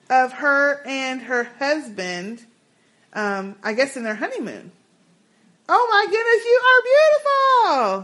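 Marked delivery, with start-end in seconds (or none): 5.7-8.0 gushing. Real, not acted. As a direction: swoon over the photo. Gushing over listener's photo.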